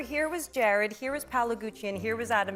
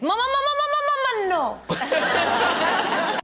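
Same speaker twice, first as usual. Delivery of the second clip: put-on child's protest; 9 semitones higher, 6 dB louder, slower than usual. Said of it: "and he goes".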